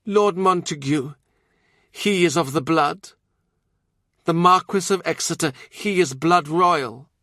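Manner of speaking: high, intense visionary nun; prophesy with fervent certainty. Acted. Nun names heirs.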